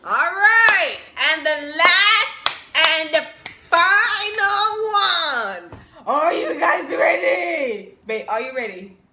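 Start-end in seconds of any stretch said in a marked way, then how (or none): 0.0-8.0 drawn-out sing-song hype, loud; hype announcer. Acted. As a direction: build up the final reveal slowly. Hypes the final item to viewers.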